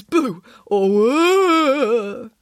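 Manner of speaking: long, high spooky wail; slow, swelling ghost howl; ghostly "boo" and moan. Acted. Boris imitating the ghost he knows.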